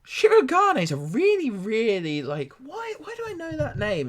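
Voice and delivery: high-pitched bewildered lady; puzzled woman. Acted. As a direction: squeak it out as a confused lady trying to remember. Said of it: "she looks at you and she goes".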